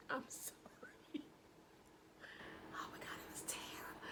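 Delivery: soft, voiceless apology squeezed through laughter; helpless laughter. Real, not acted. Storyteller apologising for laughing at a friend's fall, whispery laughing. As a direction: wheeze it out, too tickled to speak.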